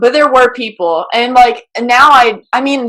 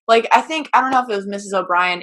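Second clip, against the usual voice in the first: ordinary delivery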